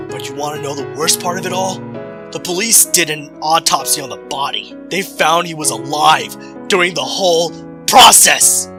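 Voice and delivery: building to the awful reveal; rising horrified intensity. Acted. Revealing the worst part.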